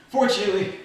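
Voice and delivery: usual speaking voice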